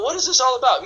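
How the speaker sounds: high-pitched questioning; curious prospect. Acted. Prospect asks about the offer, high and curious. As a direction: act out an intrigued listener, voice up. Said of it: "And they go".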